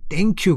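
"thank" begun with T, as "tank you"; common mispronunciation. Shown as a demonstration. Teacher imitates many learners' error, "thank" with a T. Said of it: "그러는데 그거의 중간 발음입니다".

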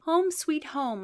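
high joyful cry. Acted. Arriving home, joyful high cry. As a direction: sing out the relief. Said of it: "I cried joyfully".

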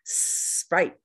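Sprite with a hissed S, no added vowel; S blend demo. Shown as a demonstration. Teacher modelling the S blend for learners. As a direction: hiss the S into Sprite. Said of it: "Show me teeth".

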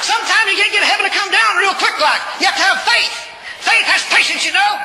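high, loud exhortation; full-force preaching. Real, not acted. Preacher exhorts the congregation to have faith, loud and high.

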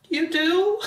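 high-pitched incredulous question; retold surprise. Woman retelling her reply to his I love you, high surprised squeak.

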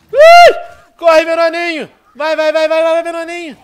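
high, loud frantic yelling; excited shouting. Real, not acted. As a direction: yell it in a panic, urging on. Zombies rush, urging himself on.

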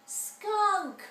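high shrieked alarm; scream. Acted. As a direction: shriek it out in fright. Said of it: "ducked under their desks and screamed".

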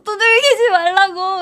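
loud weepy don't pat me; tearful protest. Retold or imitated. 등 두드리는 친구들에게 울면서 항의, tearful wail. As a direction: wail the protest through tears. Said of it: "이러면서 울면서".

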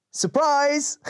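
high, loud playful shout; gleeful surprise. Acted. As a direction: jump out with a cheer. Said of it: "laughed the shadow".

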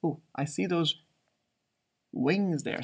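usual speaking voice

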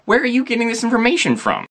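high, incredulous retort in a put-on voice; exasperated disbelief. To friends who think Minnesota is Canada, high exasperated disbelief. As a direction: act stunned that anyone could think it. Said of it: "And I'm like".

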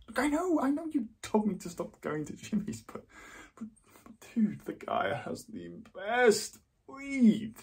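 high-pitched, whiny self-justification; defensive pleading. Acted. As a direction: plead your case to a disapproving friend. Defends himself against friend's disapproval.

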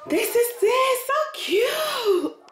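high squealing delight over the closet; a sudden high excited gush; gushing excitement. Real, not acted. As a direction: squeal with delight at how cute it is.

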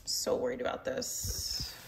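a hushed fret over the pot; worried whisper. Real, not acted. Cook frets under her breath that the rice might burn. Anxious about rice burning.

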